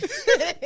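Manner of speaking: sudden high-pitched laugh; high laugh burst. Real, not acted. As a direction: squeal of laughter. Laughing at head bump.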